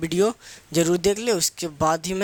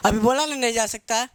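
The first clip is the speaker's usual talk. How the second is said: a touch of irritation, a bit higher and sharper; teacher mildly annoyed, refusing again; slightly heated teacher; 4 semitones higher than usual. Retold or imitated. Teacher refusing the student's repeated toilet request, mildly heated. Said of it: "teacher हल्का सा गर्मा गया".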